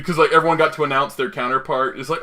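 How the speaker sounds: ordinary talk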